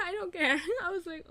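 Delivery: giggling through the words; laughing. Real, not acted. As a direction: laugh while saying it.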